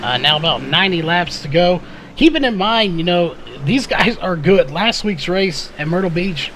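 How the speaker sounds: ordinary delivery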